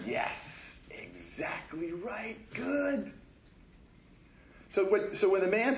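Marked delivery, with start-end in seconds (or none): 0.1-3.1 soft, slow, breathy approval; Jesus quietly affirming the invalid; approving hush. Retold or imitated. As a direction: murmur gentle agreement. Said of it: "Jesus is like".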